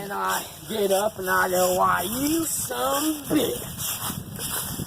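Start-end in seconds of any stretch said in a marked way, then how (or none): 1.8-4.9 high, drawn-out outraged cry; hammed-up slow outrage after the punch; mock outraged. Getting up after being decked, hammed-up outraged cry.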